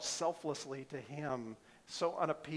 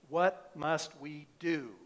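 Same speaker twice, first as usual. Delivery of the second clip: slow question; 4 dB louder, much slower than usual. Retold or imitated. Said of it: "It sounds like this".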